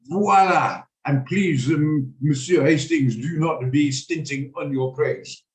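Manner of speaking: slow, hammed-up Poirot French accent; a lightly done fake French accent. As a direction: play Poirot serving a meal, with a broad fake French accent. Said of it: "in a very fake French accent".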